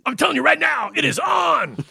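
very high, loud hype yell; over-the-top shout. Acted. Podcast host insisting it is on, hammed-up excited shouting. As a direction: holler it in mock frenzy.

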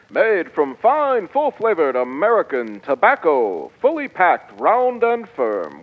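put-on upbeat old-time radio announcer; chipper 1950s ad. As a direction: sell it like a fifties pitchman.

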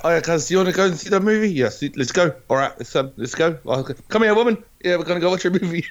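high, fast, hammed-up Kiwi bloke; hoary New Zealander. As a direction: play a rough Kiwi lad chasing a movie date. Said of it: "trying to sound hoary".

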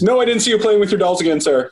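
lightly voiced Dark Helmet caught out by his officer; a hint of a flustered villain denying it, with a laugh at the end; Rick Moranis impression. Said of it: "nothing will make me laugh like him in Spaceballs".

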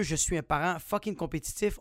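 normal talking voice

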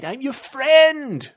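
high, loud, drawn-out coaxing; exaggerated friendly call; coaxing reassurance. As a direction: reassure the monster slowly. Bront reassures the monster.

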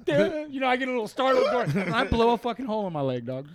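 high, loud laughing through the words; cracking up at his own blunder. Real, not acted. Telling friends how he shot himself, laughing hard.

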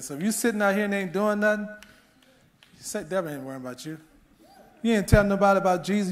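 loud, high, fast preaching push; fiery preaching. Acted. Pastor challenges comfortable believers to witness, full-force preaching. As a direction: preach hard and rouse the room.

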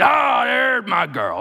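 drawn-out, high booming cry; loud, adoring holler; big bold mentor. Retold or imitated. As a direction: shout it slowly across the room in delight. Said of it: "He talked like this too".

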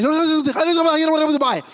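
a caller rushing on a costly phone card, hammed-up panic; frantic caller. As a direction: blurt it out before the minutes run out.

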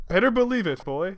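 Shadow. Acted. Shadow's voice reveal retake.

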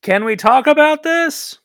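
high, slow called-out question; shouting out. Acted. Player calls to arming orcs in character, shouted appeal to parley. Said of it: "I'm gonna shout out".

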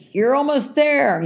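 high, slow, drawn-out encouragement; cheering stranger. Retold or imitated. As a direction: sing it out like a roadside cheerer. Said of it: "and she says".

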